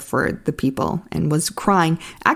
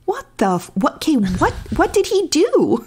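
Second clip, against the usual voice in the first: high-pitched, incredulous questioning; baffled disbelief; 11 semitones higher than usual. Real, not acted. Host baffled by a sudden movie kiss, incredulous. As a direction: voice climbing higher in exasperated bewilderment.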